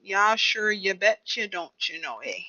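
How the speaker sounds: folksy Minnesota catchphrases, pitch falling; Minnesota accent. Shown as a demonstration. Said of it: "That's my Minnesota accent".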